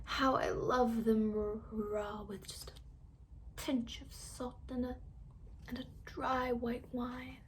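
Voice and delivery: very soft, slow, half-whispered; dreamy murmur. A narrator's reading. Fox dreaming under gas.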